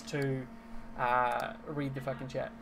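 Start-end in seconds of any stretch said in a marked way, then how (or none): 1.7-2.5 muttered to himself. Acted. Game master voices a character's secret phrase, muttered. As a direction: mutter it softly, as if to yourself. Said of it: "mutter out a, a small phrase, almost to himself".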